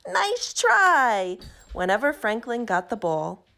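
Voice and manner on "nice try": high, louder encouraging call; cheering shout. A narrator's reading. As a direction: call it out from the sideline. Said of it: "who shouted".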